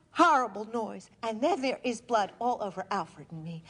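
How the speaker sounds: ordinary talk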